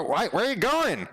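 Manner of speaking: startled question. Real, not acted. Talking to moving picture/object.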